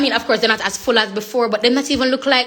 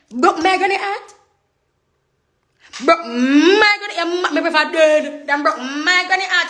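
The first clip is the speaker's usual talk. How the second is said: mock dramatic; 6 semitones higher, 4 dB louder than usual. Mock-dramatic about granny.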